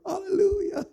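high, loud, tearful hallelujah; hammed-up worshipper overcome with joy; ecstatic crying praise. Teen mocking his own eager prayer, high tearful cry. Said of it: "I was just on earth".

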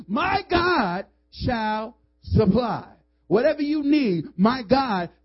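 slow, loud, stretched reply; preached answer. Real, not acted. Pastor answering the doubter, slow and loud. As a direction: answer with full conviction.

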